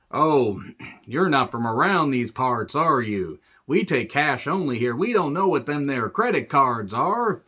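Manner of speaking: drawn-out, lilting yokel voice. Acted. Gas station local sizing up outsiders, hammed-up yokel. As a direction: play a suspicious small-town local, swooping pitch.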